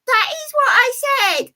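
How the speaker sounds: very high, squeaky puppet character lecturing. Acted. Puppet explains negative thinking to the viewers, very high squeaky voice. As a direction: pitch way up for the little puppet. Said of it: "it's a puppet show".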